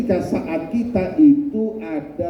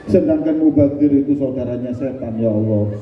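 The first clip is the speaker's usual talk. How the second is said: usual speaking voice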